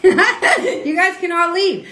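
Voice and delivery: high, slow words through a laugh; laughing dismissal. Real, not acted. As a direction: laugh them off the stream. Dismissing viewers during heated livestream.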